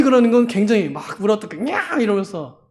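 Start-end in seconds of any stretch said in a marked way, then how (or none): 1.6-2.0 mock snapping cry.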